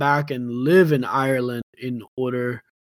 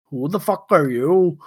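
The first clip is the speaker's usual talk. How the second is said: rough mock Irish accent on the relatives; a put-on Irish brogue, half joking. Irish relatives confront the stranger, in a mock Irish accent. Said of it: "you deserve an Oscar just for your, uh, impressions".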